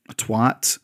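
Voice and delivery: usual speaking voice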